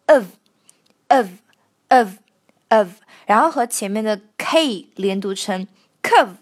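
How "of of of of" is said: "of" said slowly and reduced; weakened of. Shown as a demonstration. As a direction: drill the weak "of". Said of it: "弱化是指of念成了of".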